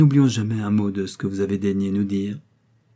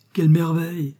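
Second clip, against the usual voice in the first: high, bright exclamation of wonder; cri d'émerveillement; delighted cry; 6 semitones higher, 3 dB louder than usual. Acted. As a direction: gasp out the marvel, pitched up. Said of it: "s'écria Miss Brandonberry".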